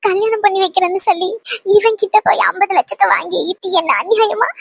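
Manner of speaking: crying, words broken by sobbing breaths; tearful despair. Acted. Daughter alone blaming her father, crying in despair. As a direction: lament through tears, pausing between phrases.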